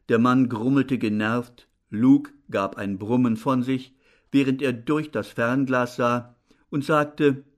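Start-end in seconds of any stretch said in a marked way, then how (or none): none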